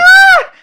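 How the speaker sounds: high loud yelp of pain; shocked scream. Retold or imitated. Friend acting out the second slap, loud yelp. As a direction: cry out as if slapped.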